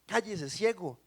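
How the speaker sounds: a touch of sharp rebuke; acted hushing of a beggar; mock scolding. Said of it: "lo reprendían para que se callara".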